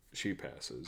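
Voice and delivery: flat low monotone. Retold or imitated. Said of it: "in the most monotone".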